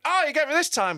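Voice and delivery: high, light mock voice of the attendant; a playful high-voiced imitation; imitating the attendant. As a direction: pitch it way up to play the cheeky attendant. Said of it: "he went".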